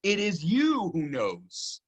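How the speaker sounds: slow, with "you" weighted and falling; slow down and lean on "you"; stress on you. Shown as a demonstration. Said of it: "Is the emphatic".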